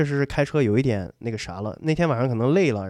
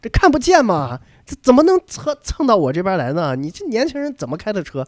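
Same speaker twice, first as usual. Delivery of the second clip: higher, louder, exasperated complaint; mock scolding; 8 semitones higher, 5 dB louder than usual. Angry driver scolding the young man who hit his car. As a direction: imitate an irritated older driver.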